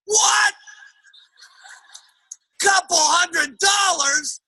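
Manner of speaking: high, slow, drawn-out shout; hammed-up appalled outburst; outraged husband. Husband learns what wife spent on the diet, outraged.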